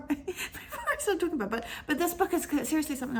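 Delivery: high-pitched laugh through the words; embarrassed laughing. Real, not acted. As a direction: laugh at your own slip-up while talking. Laughs at her own lack of checking.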